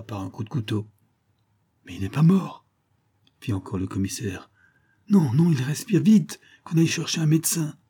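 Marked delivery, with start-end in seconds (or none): 1.9-2.7 louder, higher outburst; acted cry of discovery; startled exclamation. A narrator's reading. Commissioner finds child still alive. 5.1-7.8 loud, high, agitated call; raised voice, acted urgency; urgent order. A narrator's reading. Urgent order to fetch a doctor.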